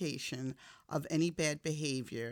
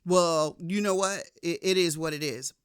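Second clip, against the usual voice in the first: louder, scoffing brush-off; dismissive shrug-off; 2 semitones higher, 8 dB louder than usual. Retold or imitated. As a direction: wave it away with a scoff. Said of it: "I could have justified it like".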